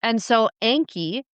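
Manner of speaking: ordinary talk, nothing marked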